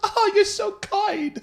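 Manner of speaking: high, slow, laughing rich-lady reply; Sherry answering the compliment with a slow high laugh; rich lady chuckle. Retold or imitated. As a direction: purr it with a posh chuckle. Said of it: "Rich lady chuckle".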